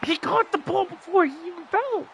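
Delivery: high-pitched outraged protest; yelling from the sidelines. Real, not acted. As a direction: shout it in disbelief at the ref. Arguing with the ref call.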